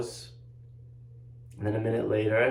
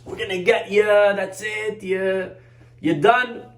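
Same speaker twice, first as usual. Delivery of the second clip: mock bully yell; 7 semitones higher, slower than usual. Bullies chasing a boy through the halls, taunting yell. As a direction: chase him down with a threat.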